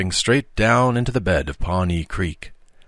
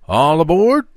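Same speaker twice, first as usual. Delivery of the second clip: loud high call of all aboard; sharp cry; 9 semitones higher, 7 dB louder than usual. Acted. Conductor calling the train's departure, loud sharp cry. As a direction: belt it out like a conductor. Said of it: "the sharp cry of".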